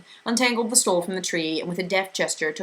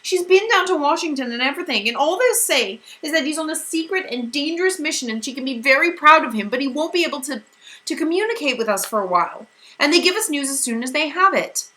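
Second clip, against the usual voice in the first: higher, strained voice fighting tears; holding back sobs; 10 semitones higher, 5 dB louder than usual. A narrator's reading. Meg telling Calvin about her missing father, near tears. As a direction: speak over a sob you keep down. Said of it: "Meg choked down a sob".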